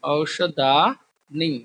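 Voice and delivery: औषधानी said slowly with a falling end; teacher repeating the plural; slow clear recital. Shown as a demonstration. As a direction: drag out औषधानी syllable by syllable. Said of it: "बहुवचन में इस तरह से औषधानी".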